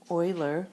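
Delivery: Euler said "OY-ler"; Euler rhyming with boiler; Euler as Oiler. Shown as a demonstration. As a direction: say Euler with an opening "oy" sound. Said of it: "pronounced Euler".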